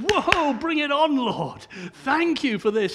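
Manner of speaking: loud, eager, hammed-up welcome of trouble; eager mock prayer. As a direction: throw your arms open to suffering, half joking. Imagined eager prayer welcoming illness.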